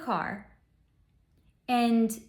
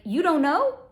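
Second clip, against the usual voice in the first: accusing; 6 semitones higher, 6 dB louder than usual. Retold or imitated.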